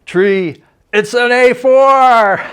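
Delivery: stretched-out excited call, voice jumping way up; excited shout. Retold or imitated. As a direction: yell it out, thrilled at the news.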